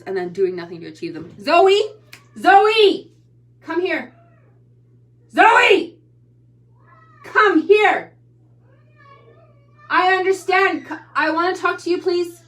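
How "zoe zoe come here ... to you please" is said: calling out. Real, not acted. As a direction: call her across the house, insistently. Mother calling daughter from room.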